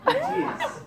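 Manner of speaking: high laughing squeak. Acted. Actor squeaks his line high, breaking into giggles.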